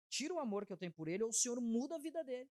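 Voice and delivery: retold impassioned prayer; pleading prayer. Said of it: "a oração dela era essa".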